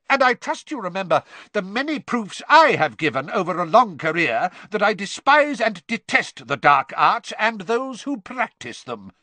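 high, loud tirade; forceful, outraged declaration; angry shout. A narrator's reading. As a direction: shout it with mounting indignation. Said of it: "Mister Crouch shouted".

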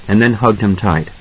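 ordinary delivery, nothing marked

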